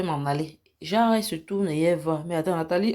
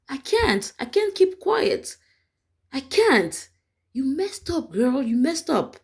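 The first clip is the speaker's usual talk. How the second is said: mock outburst; 6 semitones higher, slower than usual. Voicing Nathalie's outburst.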